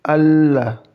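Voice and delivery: Allah, the "ll" held for two beats; Allah with held lam. Shown as a demonstration. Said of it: "lamnya ditahan dua harakat".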